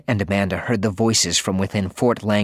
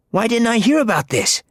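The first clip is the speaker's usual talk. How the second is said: put-on higher, indignant boy; boy voice, upset; 13 semitones higher, 4 dB louder than usual. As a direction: sound hurt to be left out.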